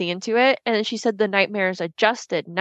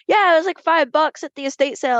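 casual friend; 7 semitones higher than usual. Retold or imitated. Friend telling Amanda the mirror was cheap, high and breezy. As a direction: toss it off brightly, high up.

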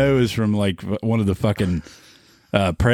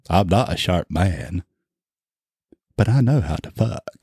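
Forrest Gump; 5 semitones lower than usual. Acted. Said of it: "I did a little Forrest Gump there".

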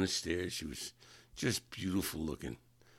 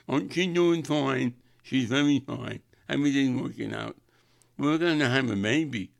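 loud, higher cleft-lip voice; imitated doctor with a speech impediment; harelip doctor; 6 semitones higher, 8 dB louder than usual. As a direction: proudly boom it out with an impediment. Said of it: "I ran into the doctor".

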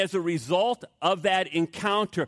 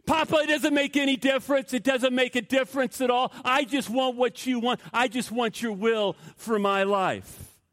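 mock pious prayer; 7 semitones higher than usual.

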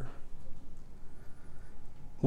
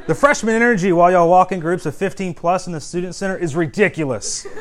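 loud, raised complaining voice; mock rant; 5 semitones higher, 5 dB louder than usual. Preacher reading student gripes to congregation, mock indignant. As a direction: read it out as a hammed-up grievance. Performing printed pirate rants.

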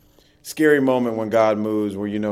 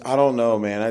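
usual speaking voice

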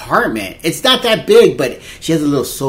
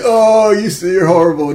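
lightly hammed-up wail, pitched higher; mock moaning complaint; 5 semitones higher, 4 dB louder than usual. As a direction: put on a whiny mock moan. Imitating what her moans might say.

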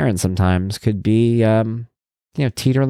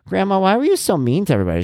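lightly voiced grandchild, higher and curious; grandchild asking; 9 semitones higher, faster than usual. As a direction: ask it like a puzzled young grandchild.

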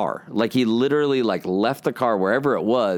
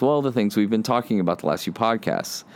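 normal talking voice

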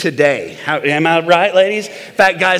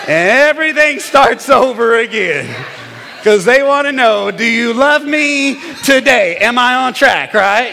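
higher and louder, pressing the ladies; fervent preaching; 6 semitones higher, 5 dB louder than usual. Real, not acted. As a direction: drive it home with rising passion. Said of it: "preaching at full force".

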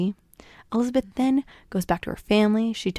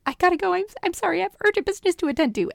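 imitated weeping distress; 11 semitones higher, faster than usual. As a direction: blurt a mock-distraught excuse up high. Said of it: "She starts weeping".

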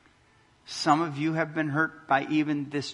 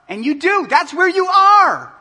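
loud, high, fervent pulpit delivery; preaching at full force; 6 semitones higher, 9 dB louder than usual. Acted. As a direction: preach it out to the whole room.

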